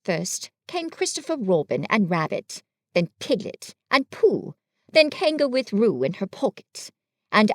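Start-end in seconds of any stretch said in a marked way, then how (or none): none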